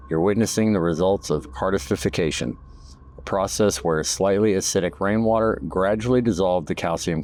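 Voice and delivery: measured, informative guided-tour read; documentary narration. Acted. Documentary narration over cave footage.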